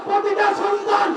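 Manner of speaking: high, impassioned preaching cry; preacher voicing a scholar's answer, high and impassioned; fiery preaching. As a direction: preach it at full force to the crowd. Preaching at full force.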